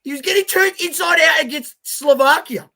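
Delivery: very high, loud exasperated rant; incredulous outburst. Real, not acted. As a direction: hammer the point home in loud disbelief. Repeats the rant with more force.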